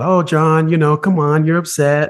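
a lightly voiced therapist coaxing him back. Therapist coaxing the upset boy to come back, lightly imitated.

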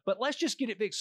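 high, loud, panicked rush of words; a contractor frantically apologizing to the narrator on the phone, high and loud; frantic apology. Retold or imitated. Contractor confessing to narrator on the phone, panicked and high.

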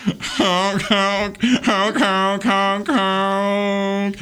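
high car horn honks; silly clown honking. Acted. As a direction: honk like a goofy car. Car voice again.

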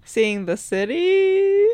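high, drawn-out teasing guess; mock-innocent guess. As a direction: offer it slowly in a high, playful lilt. Playful mock guess at travel highlight.

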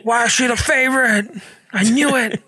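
lightly voiced complaining kid; a hint of a jealous child; whiny kid. Dad voicing his kid complaining about the favorite.

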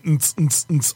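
mouthed thumping dance beat; imitated club beat. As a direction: tick out a club rhythm through the teeth. Imitating a bar's thumping music.

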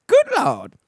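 Good Lord squeaked way up high; hammed-up falsetto Good Lord; relieved squeal. Real, not acted. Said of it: "feels oh so good to be done".

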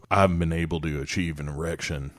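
put-on low drawling old mechanic; old Southern man voice. Imitating Duvall talking to car.

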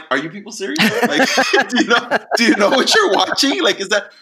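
mock exasperation at the crowd, half laughing; laughing disbelief. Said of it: "And I'm like".

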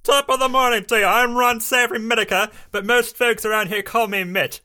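put-on British accent. Ron introducing his new invention, British accent. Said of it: "I'm going British".

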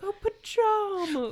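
slow, high letdown; high disappointed. Retold or imitated. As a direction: drawl it out high and let down. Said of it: "she's like".

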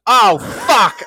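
high, loud imitated cursing; mock-anguished yell; dismayed outburst. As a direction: shout it as realization hits. Retold man's dismayed realization.

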